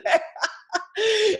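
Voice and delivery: loud, high real laugh; big laugh. Real, not acted. Teasing Jana about presenting, loud real laugh. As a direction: burst out laughing.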